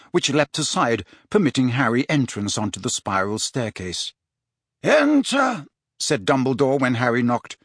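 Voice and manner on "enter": ordinary delivery, nothing marked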